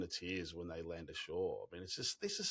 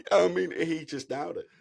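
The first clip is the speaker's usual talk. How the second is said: ordinary delivery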